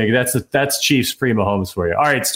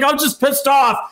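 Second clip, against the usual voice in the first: angry; 11 semitones higher than usual. Retold or imitated. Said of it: "I was like".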